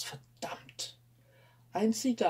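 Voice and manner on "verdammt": breathless hushed swear; whispered curse. Acted. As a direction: spit it out in a whisper. Martin curses under his breath.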